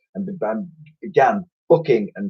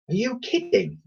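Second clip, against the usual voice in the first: put-on high, soft incredulous girl; disbelieving young girl; girl's voice; 12 semitones higher, 6 dB quieter than usual. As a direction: scoff like a plucky young girl.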